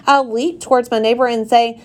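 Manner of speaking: ordinary delivery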